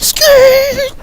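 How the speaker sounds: high screeching tire noise; loud squealing skeet. Retold or imitated. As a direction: imitate the car peeling out. Car peeling out imitated.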